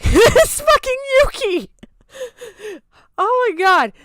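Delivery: high laughing yell; laughing shout. Real, not acted. Unmasking a friend while laughing out loud. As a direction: burst out laughing at the reveal.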